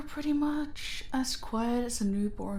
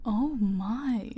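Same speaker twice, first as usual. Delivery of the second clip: ordinary talk, nothing marked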